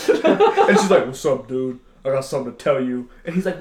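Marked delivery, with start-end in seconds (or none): 1.1-3.1 low, softer dude voice; man revealed. Retold or imitated. Guest's lover confessing on talk show, low-voiced. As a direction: drop into a low, casual man's voice.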